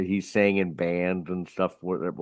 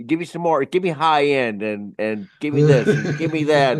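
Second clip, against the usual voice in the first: demanding singer; 5 semitones higher, 4 dB louder than usual. Retold or imitated. Singer badgering the sound engineer at his turn, mock demanding. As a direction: imitate a pushy singer, pitched up.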